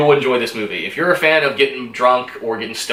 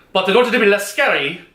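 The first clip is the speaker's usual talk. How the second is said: bad German accent; 4 semitones higher than usual. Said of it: "that was a horrible".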